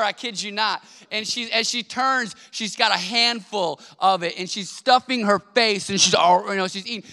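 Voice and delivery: ordinary talk, nothing marked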